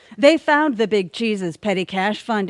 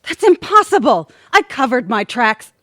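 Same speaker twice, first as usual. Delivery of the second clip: outraged outburst; 3 semitones higher, 6 dB louder, faster than usual. Acted. Villain exposed, shouting her denial. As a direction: blurt it out, caught red-handed.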